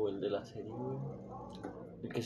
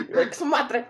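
high, loud, said through laughter; laughing through the words; 12 semitones higher, 7 dB louder than usual. Real, not acted. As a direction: repeat it while cracking up. Repeats the joke while laughing.

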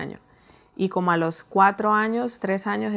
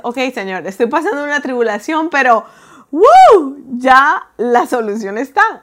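much louder, higher, jubilant cry; joyful exclamation; 6 semitones higher, 14 dB louder than usual. Retold or imitated. Believer praising the Lord in trouble, jubilant shout. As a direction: burst out in triumphant praise.

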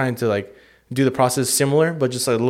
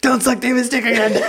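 goofy high voice; 11 semitones higher, 3 dB louder than usual. Pretend. Joking put-on voice.

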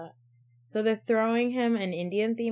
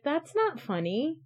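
incredulous; 7 semitones higher than usual. Retold or imitated. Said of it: "and I was like".

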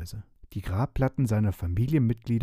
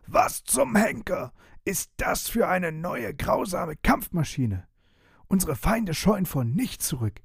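shrill furious vampire; 5 semitones higher than usual. Acted. Vampire rages at the strange war machine, higher and outraged. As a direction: rage at an unseen enemy, pitched high. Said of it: "heulte vor Wut auf".

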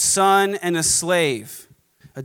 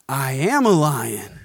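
awakened lion; 5 semitones lower, slower than usual. Acted. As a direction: lower and slow, a dawning proud declaration. Cub realises who he is.

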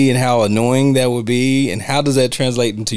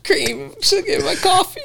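high squeaky Roger. Retold or imitated. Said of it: "That's right".

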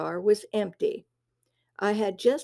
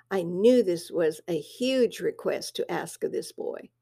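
usual speaking voice

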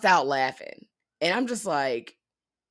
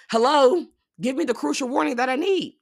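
high and loud, pleading in disbelief; exasperated plea; 9 semitones higher, 6 dB louder than usual. Real, not acted. Complaining to viewers about the characters, exasperated. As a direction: demand it like they're being dense.

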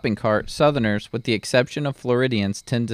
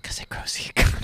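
whisper; faster than usual. Pretend. Punchline whispered joke.

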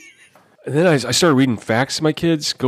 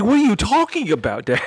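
a lightly voiced young son, pitched higher; kid voice; 8 semitones higher than usual. Dad imitating his son's confused question, higher kid voice. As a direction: play the puzzled little boy asking his dad. Kid baffled by church customs.

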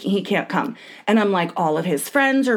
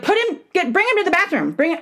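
much higher, urgent repeated order; yelling orders; 9 semitones higher, faster than usual. Retold or imitated. Wife yelling at stalling husband to take the kid, urgent and high. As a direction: push the order out, pressing and high. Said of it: "So I'm like".

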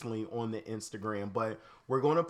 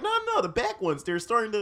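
mock high voice for the dentist; lightly voiced dentist explaining; dentist's voice; 9 semitones higher, 4 dB louder than usual. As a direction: quote her in a much higher pitch. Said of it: "she's like".

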